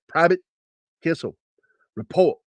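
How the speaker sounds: mildly barked order; imitated drill sergeant summons. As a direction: summon him word by word. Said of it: "he said it just like".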